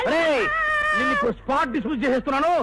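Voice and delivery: high opening yell, then rapid angry firing; furious dismissal. Acted. Boss sacking his driver on the spot, furious shout. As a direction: explode at the culprit and rush the verdict.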